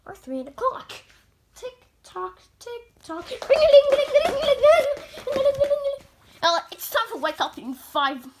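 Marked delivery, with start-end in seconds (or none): 1.5-3.3 quiet clock imitation; soft tick tock. 3.3-6.1 high loud alarm ringing. Pretend. Alarm clock imitation.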